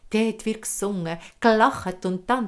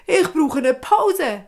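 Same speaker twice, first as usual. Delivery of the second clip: out of breath; 6 semitones higher, 6 dB louder, slower than usual. Acted. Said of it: "Er fängt an zu schnaufen".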